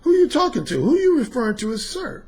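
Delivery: husband challenging his wife from across the room; jealous husband in the background. Retold or imitated. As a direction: voice the husband overhearing the call. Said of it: "I hear this voice in the background say".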